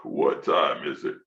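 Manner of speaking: sleepy grandma. Retold or imitated. Grandma asks the time on the phone, slow and low. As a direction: drag it out like you just woke up. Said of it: "grandma on phone".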